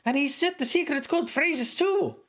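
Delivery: high, sharp woman's voice; woman cleric voice. Acted. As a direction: voice the cleric scolding him. Cleric apologizes.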